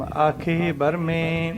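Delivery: slow recitation, a clear pause after ਬਰਮੇ; drawn-out ਆਖੇ, then stop on ਬਰਮੇ before ਆਖੇ ਇੰਦ; paced scripture reading resting at ਬਰਮੇ. Shown as a demonstration. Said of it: "ਬਰਮੇ ਦੇ ਉੱਪਰ ਵਿਸ਼ਰਾਮ".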